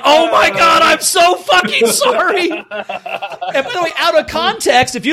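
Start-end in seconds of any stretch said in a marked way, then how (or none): 0.0-2.5 high, loud, laughing apology; mortified shout. Retold or imitated. Comic apologizing to a friend with dead parents, shouting. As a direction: blurt the apology out in horror, half laughing. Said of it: "I was like".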